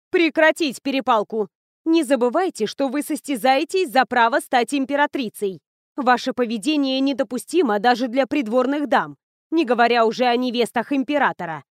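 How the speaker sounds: loud, commanding court lady; stern scolding lady. Acted.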